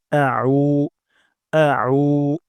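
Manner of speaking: আ with the ain sound from the middle of the throat; Arabic ain, throaty. Shown as a demonstration. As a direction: squeeze আ from the mid throat as ain. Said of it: "আইন উচ্চারণ করব গলার মাঝখান থেকে".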